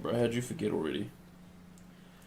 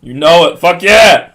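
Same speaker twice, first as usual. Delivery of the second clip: loud, high hype shout; real burst of excitement, much louder and higher; excited cheering; 6 semitones higher, 17 dB louder, slower than usual. Real, not acted. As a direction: yell it like a thrilled fan. Cheering on a favourite game character.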